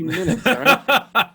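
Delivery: laughing through the words, pitch jumping high and loud. Real, not acted. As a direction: crack up at the end. Laughing during the ad read.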